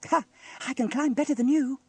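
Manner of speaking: high boastful tease; teasing. A narrator's reading. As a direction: show off cheekily. Teasing brother.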